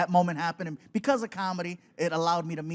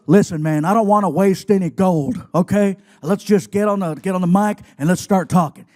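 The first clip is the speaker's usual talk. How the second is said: loud gruff no-nonsense talk; Stone Cold voice; 12 dB louder than usual. Retold or imitated. Wrestler telling the guest to start the podcast, loud gruff. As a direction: talk like a tough wrestler laying down rules.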